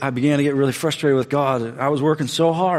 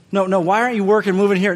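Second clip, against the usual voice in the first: exasperated protest; 7 semitones higher, 5 dB louder, faster than usual. Retold or imitated. Arguing with God.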